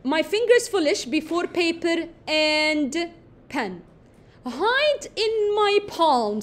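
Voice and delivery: slow, drawn-out reading of the line; slow poem reading. Shown as a demonstration. Teacher rereads the poem line slowly to her class. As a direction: linger on each word like reciting a poem.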